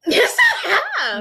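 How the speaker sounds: high-pitched, laughing objection; real amused, squealed insistence; laughing protest. Real, not acted. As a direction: protest loudly through a laugh. Laughing protest in a friendly argument.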